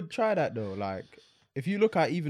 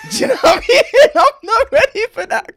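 shouting through big laughter, much higher; cracking up and yelling; laughing shout; 11 dB louder than usual. Real, not acted. Laughing at own joke.

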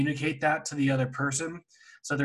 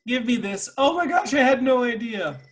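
high, squealing fake surprise; mock fake surprise; 9 semitones higher, 5 dB louder than usual. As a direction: gush like you're faking shock.

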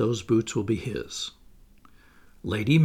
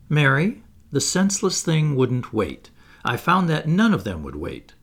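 louder, spiteful grumble about the turtle; vindictive; 5 semitones higher, 5 dB louder than usual. Acted. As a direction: hold a grudge against the beast.

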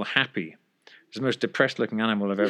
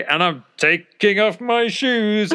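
higher, louder, slow, every word broadcast; loud narrating; 9 semitones higher, 6 dB louder, slower than usual. Retold or imitated. Flatmate narrates aloud so the girlfriend can hide, loud and slow. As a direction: say it slowly so the whole flat hears. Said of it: "very loudly".

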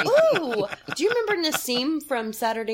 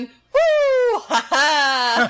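genie; 5 dB louder, much slower than usual. Retold or imitated. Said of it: "genie popping out of the lamp going".

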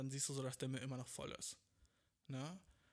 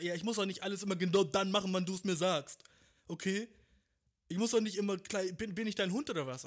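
loud, higher, furious comeback; angry shout; 4 semitones higher, 8 dB louder than usual. Acted. Partner shouting back over the trash. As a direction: yell back like you're fed up.

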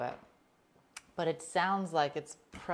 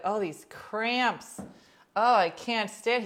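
mock moaning; 5 semitones higher, 6 dB louder, slower than usual. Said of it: "be like".

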